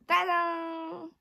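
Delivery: high, loud, drawn-out fanfare; excited reveal. Real, not acted. Vlogger reveals her new box to viewers, triumphant high call.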